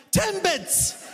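high, incredulous repeat; exasperated customer. Retold or imitated. Customer repeating the word to new cashier, high and incredulous. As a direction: squeak it out in disbelief. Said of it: "She's like".

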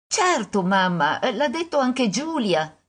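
a touch of a sure little daughter; lightly voiced little girl. Daughter assuring mother about Giulia, lightly voiced little girl.

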